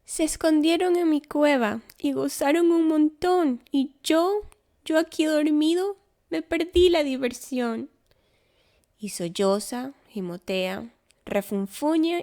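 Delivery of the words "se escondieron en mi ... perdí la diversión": higher, louder whiny bear lament; a big bear whining he missed the fun; sulking bear. A narrator's reading. Woken bear complains and cries.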